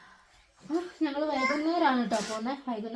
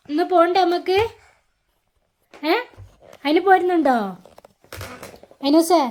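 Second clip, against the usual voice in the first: mother coaxing her son, higher and louder; 6 semitones higher, 9 dB louder than usual. Real, not acted. Mother coaxes toddler to leave.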